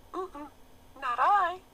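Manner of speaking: very high squeaky rat voice. A narrator's reading. The rat turning down the hen, high and squeaky.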